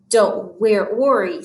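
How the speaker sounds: ordinary delivery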